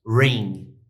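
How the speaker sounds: "ring" said in an American English accent. Shown as a demonstration. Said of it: "O americano, às vezes, chama de".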